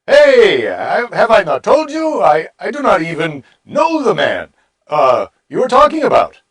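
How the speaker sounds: loud, high, hammed-up indignation; angry reply. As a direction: lash out in a put-on angry voice. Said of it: "Peter turned to them and replied angrily".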